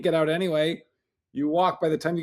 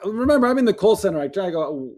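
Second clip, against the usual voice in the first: ordinary talk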